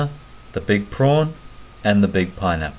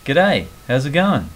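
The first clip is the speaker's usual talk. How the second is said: Aussie greeting; 4 semitones higher, 4 dB louder than usual. Shown as a demonstration. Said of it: "Example sentence".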